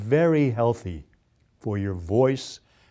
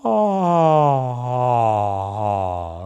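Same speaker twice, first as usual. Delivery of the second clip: open-throated ah; 4 dB louder, slower than usual. Shown as a demonstration. Coach shows the wide throat feeling, louder ah. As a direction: open wide like a sword swallower. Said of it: "your throat is sort of opening up".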